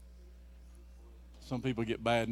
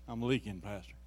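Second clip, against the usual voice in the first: ordinary delivery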